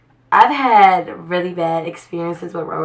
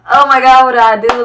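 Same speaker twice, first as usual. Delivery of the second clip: panicked; 4 semitones higher, 7 dB louder than usual. Retold or imitated. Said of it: "I'm freaking out. Like, I'm crying".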